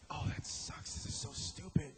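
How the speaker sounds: hushed, whispered self-criticism; mock whisper. Failing improviser judging the bad bit, in a mock whisper. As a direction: whisper it like a doubting inner voice. Imagined reaction to failed bit.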